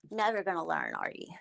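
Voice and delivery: hushed mocking whisper. Real, not acted. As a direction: whisper it as a sly aside. Answers own taunt aside.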